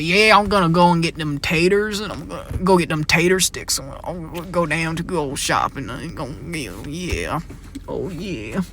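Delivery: exaggerated country twang, slowing down; mock hillbilly accent. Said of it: "people don't talk like that in Maine".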